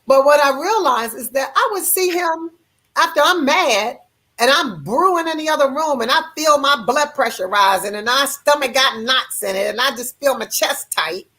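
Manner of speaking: ordinary talk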